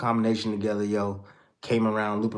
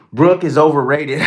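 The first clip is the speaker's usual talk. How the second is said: loud; 4 semitones higher, 11 dB louder than usual. Real, not acted. Reading a viewer's hot take.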